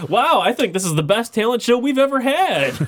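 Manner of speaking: quoting Skinner. Retold or imitated. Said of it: "he walks on the stage and he's like".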